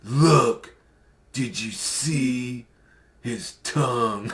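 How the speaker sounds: slow, drawn-out creepy imitation of the guy; impersonating Steven. Vlogger mocking a creepy streamer's voice, slow and eerie. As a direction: mimic his slow, eerie way of talking. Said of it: "that's how he sounds".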